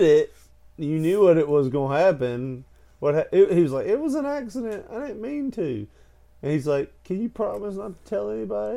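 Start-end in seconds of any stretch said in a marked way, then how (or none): 3.9-5.9 put-on pleading little boy. Boy pleading in retold story. 7.0-8.8 put-on begging little boy.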